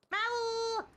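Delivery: high, loud imitated cat meow. Shown as a demonstration. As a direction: call out to the cat like a cat. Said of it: "Every time I meow".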